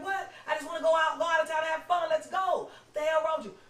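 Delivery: high, loud shout at her brother; retold shouting. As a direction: yell it like you've lost your voice doing it. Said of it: "I didn't have no voice".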